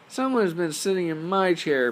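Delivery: high, loud squeaky cub; Baby Bear's tiny voice. Acted. Baby Bear finds his chair used, high squeal. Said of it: "in his tiny little voice".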